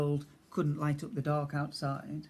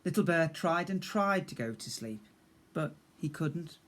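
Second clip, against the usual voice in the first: ordinary delivery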